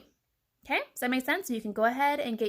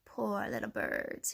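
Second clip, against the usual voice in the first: low, soft, slow fox; smirking; 10 semitones lower, 5 dB quieter, slower than usual. A narrator's reading. Fox eyeing the birds, smirking softly. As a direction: drop it down into sly mock pity. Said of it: "he smirked".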